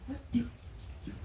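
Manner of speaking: soft, halting, unsure whisper-like voice; hesitant hush; hushed hesitant quote. Retold or imitated. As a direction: stammer it quietly, nervous about choosing. Friends nervously deciding who goes.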